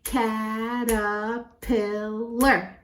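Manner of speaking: "Caterpillar" very slowly in four drawn-out beats; syllable by syllable. Shown as a demonstration. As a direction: count out every syllable of "Caterpillar". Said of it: "count them".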